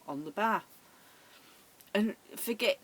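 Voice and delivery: ordinary talk, nothing marked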